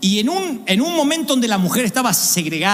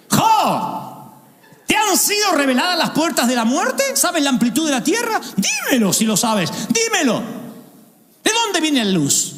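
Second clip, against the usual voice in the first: God's thundering questions; 7 semitones higher than usual. Acted. God questioning Job.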